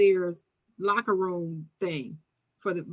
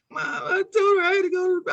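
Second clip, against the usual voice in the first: crying; 9 semitones higher, 4 dB louder, faster than usual. Retold or imitated. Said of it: "I'm crying".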